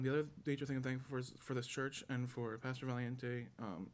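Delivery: ordinary talk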